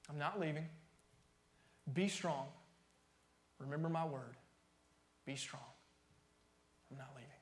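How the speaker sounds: starts plain and fades to a near-silent hush; from spoken to barely audible; fading promise. Acted. Voicing God's promise, fading away.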